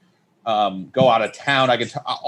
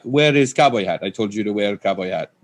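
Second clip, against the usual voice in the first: put-on annoyed Persian accent; 3 semitones lower than usual. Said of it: "came up to her annoyed".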